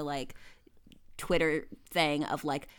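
ordinary delivery

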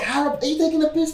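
outraged disbelief, voice leaping high; incredulous. Real, not acted. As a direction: pitch shoots up in disbelief. Incredulous pitch spike.

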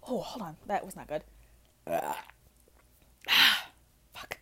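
breathy under-the-breath aside; whispered aside. Real, not acted. Muttering to the audience after a bad sip, whispered. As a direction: murmur it to yourself, almost no voice.

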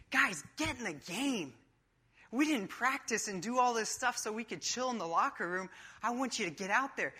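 coach's pep talk. Acted. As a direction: fire up the team before the game. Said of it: "He speaks as, like, the coach".

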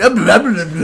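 loud, high mumbo-jumbo imitation; mock gibberish. Friend imitating unintelligible movie dialogue, mock gibberish. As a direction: garble it as imitated incomprehensible speech. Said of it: "it sounded like he went".

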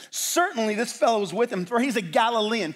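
loud, high-pitched accusation; insistent assertion. Acted. Bystander points out Peter as a Galilean, insistent accusation. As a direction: assert it as a certain fact. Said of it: "another one asserted".

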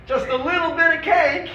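mock shock at the strictness; high disbelieving squeak on cake; incredulous. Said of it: "he says".

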